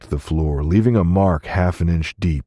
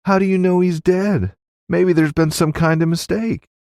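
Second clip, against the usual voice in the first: high feminine southern voice; disbelieving woman; 14 semitones higher than usual. A narrator's reading. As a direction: plead for it to be untrue. Woman doubts the death.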